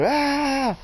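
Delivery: high scream at ordinary volume; mock scream. Storyteller replaying the scream on the ride, high mock scream. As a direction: re-enact a ride scream, high but contained. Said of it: "I just started screaming".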